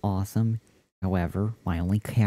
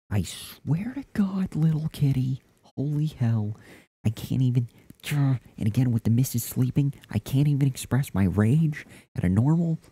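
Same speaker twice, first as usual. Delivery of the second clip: strained, raised frustrated stage whisper; whispering in frustration; 7 semitones higher, 6 dB louder than usual. Real, not acted. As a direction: vent rage while keeping it hushed. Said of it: "I have to whisper in frustration at you".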